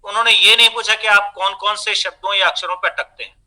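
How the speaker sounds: ordinary delivery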